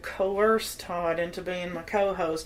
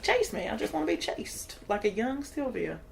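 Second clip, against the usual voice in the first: voicing the dog; 2 semitones higher, 4 dB quieter than usual. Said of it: "She's like".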